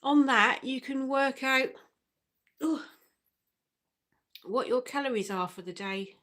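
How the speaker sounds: speaking through hiccups. Real, not acted. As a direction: hiccups breaking the words. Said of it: "I've got hiccups in".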